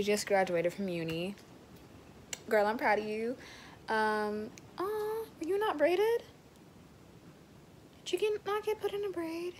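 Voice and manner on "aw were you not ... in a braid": cooing sympathy. Real, not acted. Cooing at the video creator.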